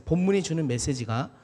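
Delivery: ordinary talk, nothing marked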